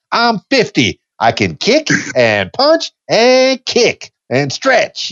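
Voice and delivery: high, slow, hammed-up peppy middle-aged woman; a playful high-voiced send-up of turning fifty; Molly Shannon character. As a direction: bounce it out high and slow like a proud sketch character. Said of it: "I likened myself to that Molly Shannon character".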